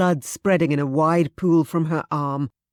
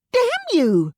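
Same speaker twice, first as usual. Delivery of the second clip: high, loud cry of rage; furious shout; 5 dB louder than usual. Acted. Louisa shouting at Ellen in blind rage. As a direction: spit it out in fury. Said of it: "Louisa shouted".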